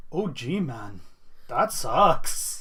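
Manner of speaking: put-on Canadian accent. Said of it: "I can't do a Canadian accent".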